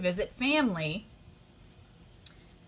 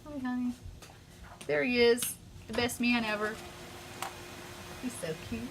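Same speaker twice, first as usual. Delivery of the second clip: affectionate cooing; 4 semitones higher, 4 dB quieter, faster than usual. Real, not acted. Cooing to someone off camera.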